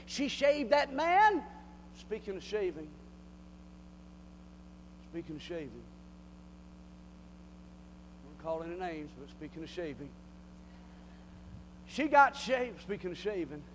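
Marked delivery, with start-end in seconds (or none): 2.0-10.1 much softer and lower sly aside; teasing aside. Acted. 12.8-13.6 much softer and lower sly aside; teasing aside. Acted.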